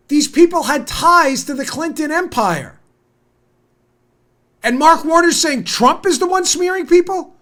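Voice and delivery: high, loud exasperated tirade; outraged yelling. Real, not acted. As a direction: shout in disbelief at a hypocrite. Outraged rant addressed to Warner.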